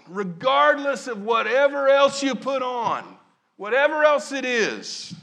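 loud rising sermon climax; preaching at full force. Real, not acted. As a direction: build the list up into a ringing shout.

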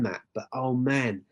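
usual speaking voice

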